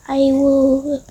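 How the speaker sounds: ordinary delivery, nothing marked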